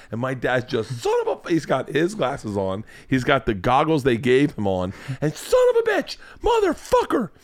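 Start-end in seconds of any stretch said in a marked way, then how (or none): 1.0-1.5 thin-volume comic shriek; dad screaming. Retold or imitated. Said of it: "looking at my dad screaming". 5.3-7.3 very high mock scream, held back in volume; comic squealed swearing; dad screaming. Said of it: "looking at my dad screaming".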